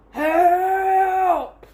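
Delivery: desperate yell for help; shouting for help. Acted. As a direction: cry out for help, stuck.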